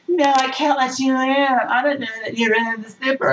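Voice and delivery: prissy high-voiced no; mock wary girl. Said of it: "She's like".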